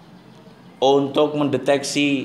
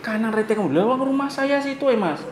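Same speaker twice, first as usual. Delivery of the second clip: higher, lightly voiced auntie blaming the other rider; a hint of a stubborn auntie; mock scolding auntie; 9 semitones higher than usual. Teacher imitates an auntie blaming the rider who hit her. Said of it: "dia menyalahkan yang nabrak".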